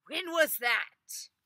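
high, loud, slow question; playing innocent. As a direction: feign not knowing. Ivan plays dumb.

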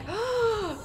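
sharp, high intake of breath; shocked gasp. Real, not acted. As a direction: gasp as if caught off guard. Shocked by deaths in game.

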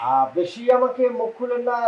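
angry warning. Acted. Son-in-law warns father-in-law in quarrel.

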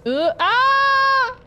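long high loud scream; terrified scream. Real, not acted. Gamer ambushed by monster, loud high scream. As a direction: scream as the attack lands. Attacked in game.

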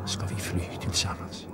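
soft whispered question. A narrator's reading. Young man whispering into her ear, soft whisper. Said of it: "viskade han i hennes öra".

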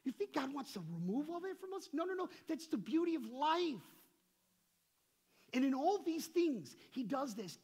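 loud, high-pitched sermon delivery; impassioned preaching. Real, not acted. Preacher explains God's unreachable presence to the congregation, impassioned. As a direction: preach it out at full force.

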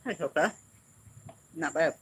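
talking while chewing candy; muffled by food in mouth; mouth full, chewing. Real, not acted. Seen 0: Just bit the cup at -3; lips pressed, chewing while talking.

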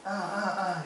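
high, urgent "uh, uh" noises; imitated wordless grunts. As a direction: mimic a man straining without words. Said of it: "he can't communicate to me verbally".

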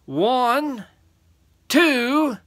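high, loud, deliberate counting; threatening count. Acted. Third giant counting at the boy, threatening.

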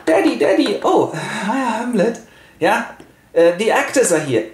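put-on high, girlish daughter voice; excited Ophelia. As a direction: run in calling for Daddy, high-pitched. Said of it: "And Ophelia comes back".

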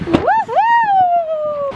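high drawn-out cheer; cheering woohoo. Real, not acted. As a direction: celebrate the little one's success. Cheering toddler.

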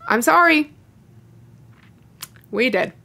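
ordinary talk

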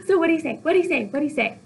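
high, pestering repeated question; eager Juliet. Acted. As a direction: beg for the news like an impatient girl. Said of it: "Juliet is trying to get".

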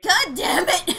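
loud high God damn it!; frustrated shout. Real, not acted. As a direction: let it burst out in frustration. Frustrated outburst.

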